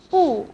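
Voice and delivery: 不 alone with a high, sharply falling tone; fourth tone on 不. Shown as a demonstration. Teacher showing 不 standing alone, in falling fourth tone. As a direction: say 不 starting high and dropping. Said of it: "in the sentence have two".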